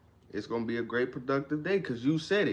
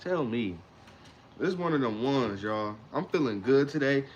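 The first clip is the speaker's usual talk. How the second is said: usual speaking voice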